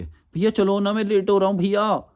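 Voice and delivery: put-on high, pleading girly whine; mock feminine begging; man doing a woman's voice. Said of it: "लड़की की तरह आवाज़ में बात करने लग जाएंगे".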